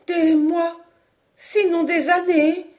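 high, stunned echo of bad news; appalled. A narrator's reading. Mother repeats her son's words, appalled. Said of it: "répétait madame Caudal atterrée".

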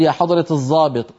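الضابط said as an emphatic z, the Egyptian way; colloquial z. Retold or imitated. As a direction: address the officer in street Arabic. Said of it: "العامة تقول". (الضابط بالظاء العامية)

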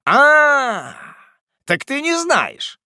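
drawn-out, high, loud "ah"; indignant and drawn out; menacing mock realisation. Master scolds the clueless servant.

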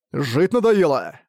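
slow, threatening snap; impatient bark. Acted. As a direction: snap at him, out of patience. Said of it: "рявкнул теряющий терпение Арк". (нетерпеливый рык)

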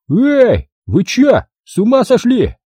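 high, alarmed character yelling; frantic yell. A narrator's reading. Owner sees a bulldozer coming at him, frantic yell. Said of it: "истошно завопил он".

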